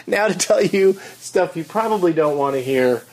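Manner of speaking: high, giggly delivery still laughing; laughing through it. Real, not acted. Host introducing the voicemail guest, still laughing. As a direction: get the words out through leftover laughter.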